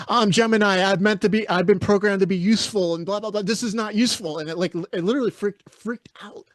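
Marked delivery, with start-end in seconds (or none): 0.1-4.4 high, loud indignant AI retort; mock an offended assistant snapping back; snappy attitude. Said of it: "had an attitude and snapped at her".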